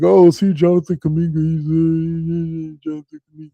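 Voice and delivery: high, loud mock-gloating fan voice. Imitating smug fans bragging to him, high and loud mockery. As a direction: ham up the smug rival fans. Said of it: "those people who were like".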